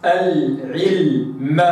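"العلم" very slow, sakin lam held its full length; slow recitation of al-ʿilm. Shown as a demonstration. Teacher reciting "العلم" with the lam held long. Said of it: "هكذا".

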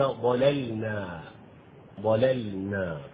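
ضللنا slowly, second lam clearly sakin; distinct sakin lam; clear sukun. Shown as a demonstration. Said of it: "يجب أن تظهر اللام الساكنة الثانية".

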